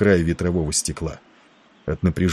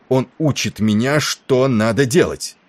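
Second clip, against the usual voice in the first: raised pitch, indignant officer; angry exclamation; 6 semitones higher than usual. A narrator's reading. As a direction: protest in outrage, pitch held high. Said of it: "гневно воскликнул Тернер". (возмущённое, гневное восклицание)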